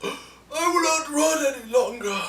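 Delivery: gasp, then high strained impression; hammed-up high-pitched character declaration; imitating his voice. Reviewer mimicking the rebel leader's last stand, high exaggerated imitation. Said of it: "he talked like this".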